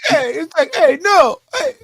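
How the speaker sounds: mock Cambodian accent, high; a quick hammed-up Cambodian accent. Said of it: "I can't even do Cambodian accent".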